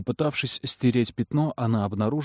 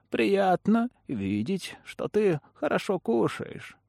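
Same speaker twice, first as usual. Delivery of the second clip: put-on high, slow, honeyed old woman; sweet, sinister smiling crone; Ламия; 9 semitones higher, slower than usual. A narrator's reading. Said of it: "сказала Ламия с улыбкой". (приторный голос старой ведьмы)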